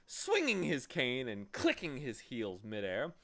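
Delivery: ordinary delivery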